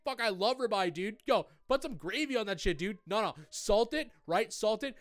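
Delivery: high, hyped-up dudebro gushing; over-the-top excited bro voice; bro chef impression. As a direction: gush like a stoked bro at a steakhouse. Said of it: "And you're just like".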